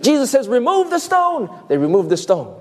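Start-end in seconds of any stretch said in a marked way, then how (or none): 0.5-1.5 high-pitched, emphatic order; acted command. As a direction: command it as Jesus would. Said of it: "commands the people to remove the stone".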